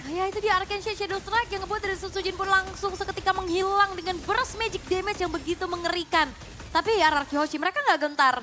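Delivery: fighting game announcer. Real, not acted. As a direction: ride the excitement of the play. Hype play-by-play of the fight.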